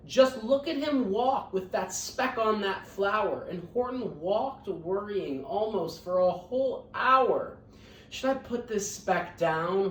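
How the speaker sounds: lively, sing-song storybook reading; reading to children. A narrator's reading. Teacher reading Horton Hears a Who to her scholars, lively storybook voice. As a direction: read it out warmly to a class of kids.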